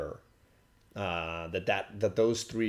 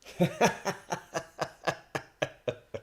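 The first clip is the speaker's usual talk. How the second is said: real, pitched-up cackling laugh; long laugh; 7 semitones higher than usual. Real, not acted. Dad after his own punchline, long laugh. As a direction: laugh in a long run of bursts.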